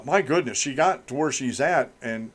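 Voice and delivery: normal talking voice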